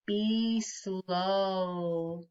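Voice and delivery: a drawn-out, calm be slow; slowly. Shown as a demonstration. Teacher modelling the calm breath line for kids, slowly. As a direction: stretch "slow" out like a long breath.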